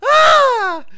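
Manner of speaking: sudden high shouted yelp; random shout. Pretend. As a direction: blurt out a loud yelp. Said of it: "I'm just gonna keep doing that randomly".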